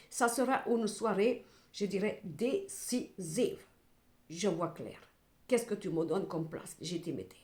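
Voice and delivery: ordinary talk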